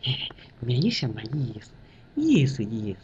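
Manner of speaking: quiet, deferential murmur; humble subordinate. Retold or imitated. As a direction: play it down humbly and softly. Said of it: "小张说：".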